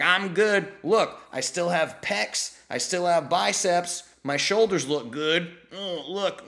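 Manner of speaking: hammed-up self-satisfied athlete; mock boasting. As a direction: show off proudly, poking fun at the boaster. Ex-athletes quoted showing off muscles.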